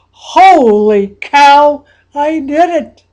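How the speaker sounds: loud, drawn-out holy cow; ecstatic exclamation. Acted. Coach anchoring the winning feeling, loud ecstatic. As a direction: let the triumph explode out. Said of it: "your mind exploding with".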